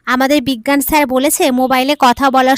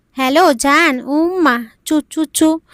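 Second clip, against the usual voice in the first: kissing sounds; 2 semitones higher, slower than usual. Retold or imitated. Wife sends kisses over phone.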